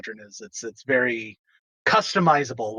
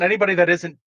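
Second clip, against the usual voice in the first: ordinary delivery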